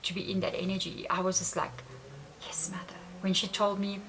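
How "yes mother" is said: very soft, hushed and adoring; loving reverence. Retold or imitated. Answering the spirit Mother Aya, soft loving reverence. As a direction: breathe it out with devotion. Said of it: "with so much love".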